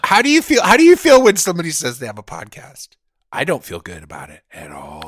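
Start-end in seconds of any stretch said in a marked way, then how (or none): 0.0-2.9 much higher, louder, fast questioning voice; mock interviewer. Cohost quizzing in a high mock reporter voice. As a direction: ask like a cheesy reporter. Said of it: "mock interviewer question".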